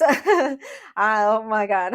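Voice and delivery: loud burst of emotional laughter; real, loud, helpless laugh; overwhelmed laughing. Real, not acted. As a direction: laugh it out while trying to regain composure. Laughing while close to tears.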